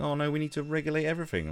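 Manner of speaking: high, fast mock panic; mocking panicky voice. Mocking people who want regulation, fast and high. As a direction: parody the hand-wringers. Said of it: "people going".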